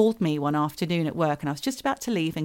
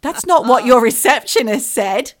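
high, loud acted shock; indignant disbelief; 6 semitones higher, 8 dB louder, slower than usual. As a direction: challenge the doctor, mock outraged. Acted outraged reply to doctor.

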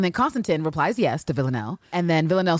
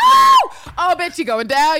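much higher, louder hammed-up shriek; mock shriek; 12 semitones higher, 10 dB louder, slower than usual. Paul vows revenge for his broken statue, shrieking. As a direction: screech the threat like a scorned queen. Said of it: "They're like".

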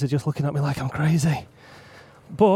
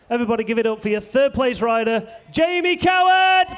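podium announcer; 6 semitones higher, 3 dB louder than usual. Real, not acted. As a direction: hype the rider's name like a ring announcer.

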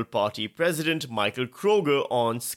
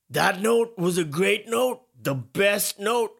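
slow bragging; 9 semitones higher, slower than usual. Retold or imitated. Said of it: "My Trump impression is terrible".

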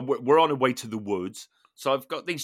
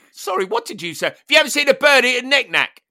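calling out; 10 semitones higher, 5 dB louder than usual. Retold or imitated. Repeating question to confused listener.

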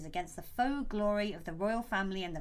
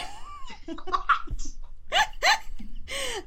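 embarrassed laugh. Real, not acted. Laughs after accent attempt.

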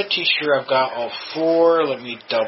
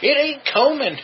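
mocking girl voice; 12 semitones higher, 3 dB louder than usual. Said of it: "It's like".

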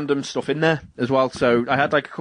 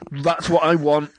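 normal talking voice

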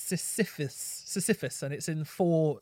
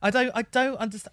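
usual speaking voice

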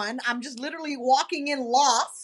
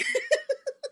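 a sudden high burst of laughter; real high-pitched laugh; 13 semitones higher, 3 dB quieter than usual. Real, not acted. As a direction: laugh up high, caught off guard. Amused by friend's interview story.

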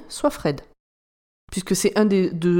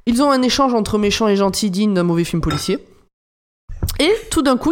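ordinary delivery

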